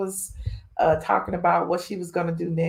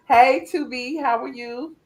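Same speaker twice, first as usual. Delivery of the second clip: ordinary talk, nothing marked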